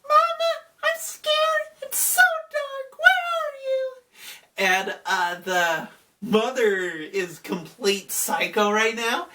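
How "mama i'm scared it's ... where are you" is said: put-on very high little child voice, frightened; scared toddler. Toddler's voice calling for mama in the dark, very high. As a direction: whimper like a lost two-year-old. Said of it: "the two-year-old's voice is like".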